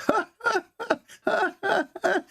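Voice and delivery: high mocking ha ha ha of a laughing devil. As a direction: cackle high like the devil in the mouth. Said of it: "and he is laughing".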